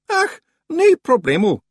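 high, loud Scots reassurance; quick stranger's cover-up. A narrator's reading. Stranger brushing off the librarian's question, high loud Scots. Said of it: "said the figure quickly".

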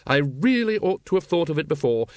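high-pitched, emphatic lady's voice; high, well-meaning expert chatter; enlightened lady. A narrator's reading. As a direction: gush the explanation as it dawns on you. Lady Ramkin explains her realisation.

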